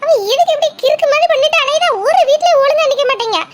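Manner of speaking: high, loud, exasperated outburst; agitated reproach. Acted. Friend berates the absent girl for taking poison, agitated reproach. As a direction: scold in alarm, pitched well up.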